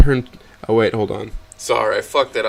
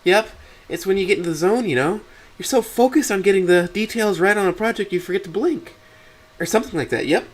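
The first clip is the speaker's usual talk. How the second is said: higher-pitched daughter, brushing it off; breezy daughter voice; 9 semitones higher than usual. Acted. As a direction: play a daughter casually explaining herself. Voices Amber playing it cool.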